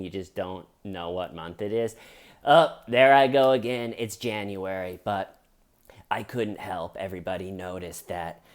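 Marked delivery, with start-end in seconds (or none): 2.4-8.4 mock muted star, correcting himself; Alec Baldwin. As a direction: address followers in a subdued, fumbling voice. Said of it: "Alec posted a video where he was like".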